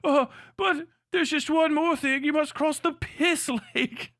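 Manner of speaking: lightly voiced pushy wizard; mock wizard. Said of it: "cut back to the guys playing".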